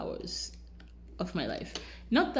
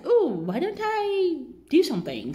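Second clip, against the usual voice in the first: much higher and louder, breezy self-mockery; mock bright idea; 10 semitones higher, 6 dB louder than usual. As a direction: laugh at her own bad plan. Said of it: "Then I was like".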